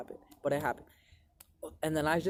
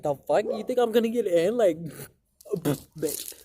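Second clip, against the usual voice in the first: mock disbelief, pitched higher and louder; retold incredulous outburst; 4 semitones higher, 5 dB louder, slower than usual. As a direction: throw it back at her like it's absurd. Retelling refusing aunt's ride.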